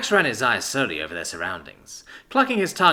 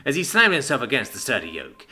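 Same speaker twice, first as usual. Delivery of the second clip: ordinary delivery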